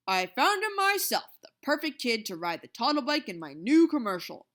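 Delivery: loud proud declaration; announcing. A narrator's reading. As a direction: proclaim it to the room. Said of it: "He announced".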